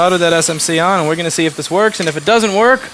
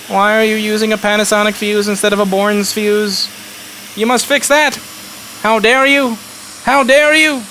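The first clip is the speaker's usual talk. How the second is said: mocking outraged viewer; 6 semitones higher than usual. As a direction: ham up an indignant nitpicker. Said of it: "Why don't you complain".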